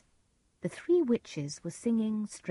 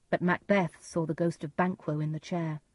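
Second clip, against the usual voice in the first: ordinary delivery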